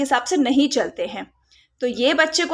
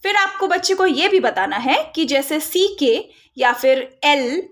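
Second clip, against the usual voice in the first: ordinary talk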